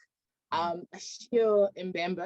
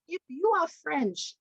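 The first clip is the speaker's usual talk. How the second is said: indignant inner retort; 8 semitones higher, slower than usual. Retold or imitated. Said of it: "in my mind".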